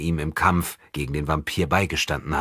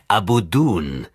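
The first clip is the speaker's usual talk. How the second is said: slow, drawn-out name, slightly louder; gespielt erinnernd; 2 semitones higher, 4 dB louder, slower than usual. A narrator's reading. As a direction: draw the name out as if just recalled. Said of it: "sich des Namens zu erinnern". (gedehnt, wie mühsam erinnert)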